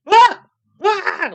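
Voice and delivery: two sharp high screams, very loud; screaming at each other. Retold or imitated. Roadies meeting the hanging man, screaming. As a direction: let out startled shrieks at each other. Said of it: "Like, screaming at each other".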